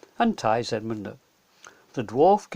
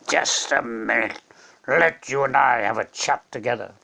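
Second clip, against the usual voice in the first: calm lion king; 3 semitones lower, 3 dB louder than usual. Acted. Aslan calms the witch.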